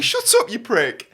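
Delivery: retorting yell. Retold or imitated. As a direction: re-enact shouting back at a driver. Repeats own retort to driver.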